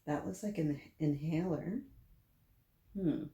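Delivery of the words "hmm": ordinary delivery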